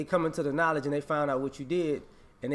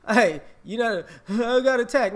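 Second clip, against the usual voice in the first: louder, higher mock protest; mock victim complaint; 7 semitones higher, 8 dB louder than usual. Mocking a scammer who says he was attacked, loud and high. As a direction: play the scammer crying foul.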